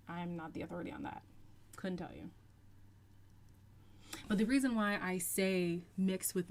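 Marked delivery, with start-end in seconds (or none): 0.1-2.3 fast, soft throwaway disclaimer. Real, not acted. Answering a viewer's question, quick soft throwaway. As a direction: rattle it off softly, letting it drop.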